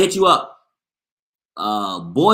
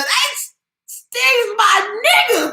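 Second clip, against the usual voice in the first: hype shout-out; much slower than usual. Acted. Hyping up Stiggs on stream.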